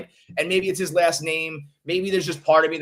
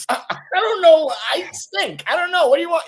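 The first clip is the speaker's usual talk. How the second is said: mock helpless self; 11 semitones higher, 4 dB louder than usual. Imagining himself out there batting, high mock-helpless whine. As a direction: play a hopeless struggling batter, pitched way up. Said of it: "if I was out there just like".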